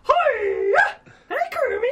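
very high, squealing puppet diva; Miss Piggy. Acted. Party-piece impression for doubting co-hosts, Miss Piggy voice. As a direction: shriek the greeting in a loud falsetto. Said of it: "Miss Piggy".

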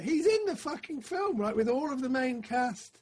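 loud, high-pitched disbelief; amazed exclamation. Real, not acted. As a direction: burst out high and loud, astonished. Amazed at the recast actor's presence.

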